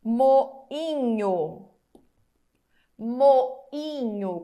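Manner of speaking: Moinho drawn out, each syllable separate; slow syllable demo. Shown as a demonstration. Teacher showing the class a word, slowly syllabled. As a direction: say Moinho slowly, letting the vowels split. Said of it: "Como que ficaria?".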